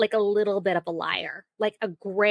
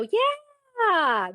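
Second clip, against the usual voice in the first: much higher, stretched yeah; lazy lie to end the chat; breezy fake yeah; 3 dB louder than usual. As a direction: brush mom off with a sing-song yeah. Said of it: "I'll go".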